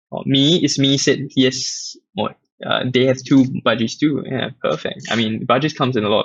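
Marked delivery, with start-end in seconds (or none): none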